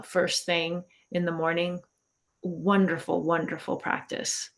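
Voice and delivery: usual speaking voice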